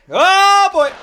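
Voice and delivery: high loud dread at where this is going. Real, not acted. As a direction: react with dread. Dread reaction.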